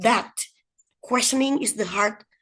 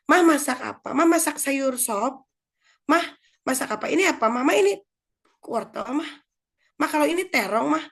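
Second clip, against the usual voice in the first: put-on higher-pitched pestering little kid; curious child; 6 semitones higher than usual. Child quizzing mother about the vegetables, curious. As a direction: keep badgering Mom, childlike. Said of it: "anaknya ikut ke dapur ya".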